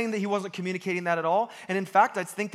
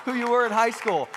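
hammed-up grateful mother; mock relieved mom; 7 semitones higher, 6 dB louder than usual. Preacher voicing his mom's relief at his change. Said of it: "she's got that look of desperation like".

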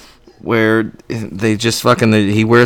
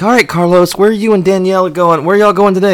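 bright higher TV-host voice; higher, louder mock host; Andy Cohen; 8 semitones higher, 4 dB louder than usual. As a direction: cheer it like a gushing talk-show host. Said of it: "and he's like".

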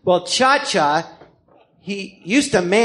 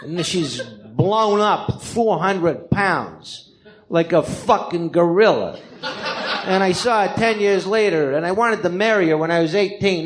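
Cha Cha impression. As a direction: stretch the key words like a New York tough guy. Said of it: "And he talks like this".

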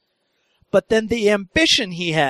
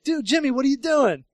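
higher, breezy hello; friendly greeting; 8 semitones higher than usual. Retold or imitated. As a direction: greet him cheerfully. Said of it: "I'm like".

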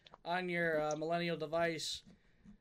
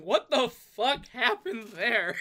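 mock shocked outcry; 5 semitones higher, 7 dB louder, slower than usual.

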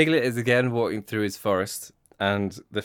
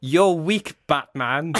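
high, loud mock shout; comic belligerent shout; Aggressive Piglet; 6 semitones higher, 4 dB louder, slower than usual. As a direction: yell it as a taunting punchline. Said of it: "and shouts".

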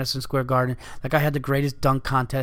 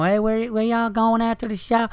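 sweet Southern woman; 9 semitones higher than usual. Retold or imitated. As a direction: coo it like a friendly Memphis local. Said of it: "they make you feel like everything's okay 'cause of their voice".

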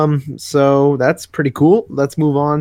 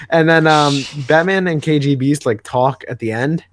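normal talking voice

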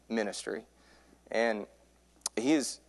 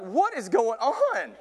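high, drawn-out bewildered cry; acted panic; 11 semitones higher, slower than usual. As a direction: stretch it out in comic panic. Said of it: "I'm just panicking".

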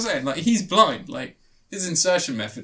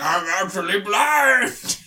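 very slow, odd voice for the twist; slow creepy reveal; 4 semitones higher, 4 dB louder, much slower than usual. Pretend. Imagining a twist ending, the sister reveals herself slowly. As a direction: drag out the reveal like a horror twist.

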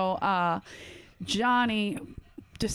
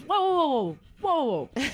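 high, swooping string of whoas; mock amazement; 5 semitones higher than usual. Football player halting a fan's stats, mock amazement. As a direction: imitate a stunned man calling a halt. Acts out McNabb interrupting in disbelief.

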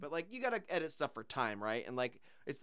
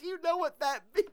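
high, squealing through laughter; laughing; 14 semitones higher, 5 dB louder than usual. Real, not acted. Retelling a film joke to a co-host, laughing. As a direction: crack up while repeating the punchline.

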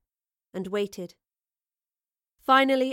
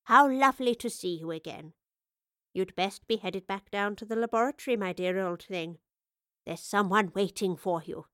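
lightly voiced old woman, fond; a hint of an old woman saying a tender goodbye; elderly professor. Old professor fondly bids farewell.

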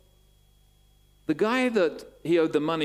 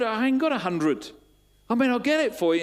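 high, louder acted excuse; protesting; 10 semitones higher, 5 dB louder than usual. Debtor answers the man demanding his hundred pounds, protesting. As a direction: play a cornered debtor stalling urgently.

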